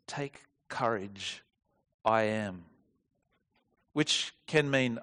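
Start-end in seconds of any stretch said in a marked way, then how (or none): 0.1-2.6 slow, quieter "Take courage. I am." with weight on "Take"; slow emphasis. Shown as a demonstration. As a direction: lay out each word deliberately. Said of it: "He literally says".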